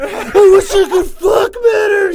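hammed-up anguished howl of shame; mock crying wail. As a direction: wail it like a ridiculous crybaby. Mocking the killer's shame.

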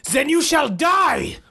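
high, slow, shouted threat; a hammed-up murderous shout; killer's scream. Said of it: "Curtin screamed".

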